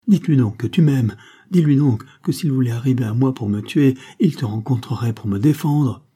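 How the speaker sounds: loud, high, frantic begging; panicked, crying out for him to defend her; terrified plea. Acted. Carmela begs the vicomte in terror, loud and frantic.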